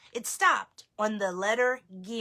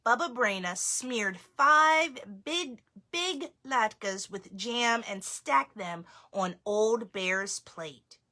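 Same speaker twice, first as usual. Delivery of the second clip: ordinary delivery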